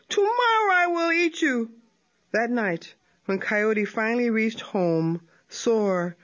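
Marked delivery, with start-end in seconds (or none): 0.1-1.7 higher, crying out a threat while fleeing; a touch of a howling cry. Acted. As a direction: wail it as you run off hurt. Coyote runs howling away.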